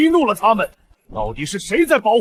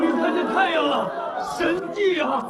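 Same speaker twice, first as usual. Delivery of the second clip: ecstatic laugh; 7 semitones higher, 5 dB quieter than usual. Acted. Zealot greets deadly sunlight.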